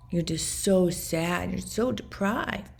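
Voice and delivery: slow, drawn-out fake sympathy; mock pity. Abuser belittling her on trips, imitated in slow mock pity. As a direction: imitate a condescending taunt, stretching the words.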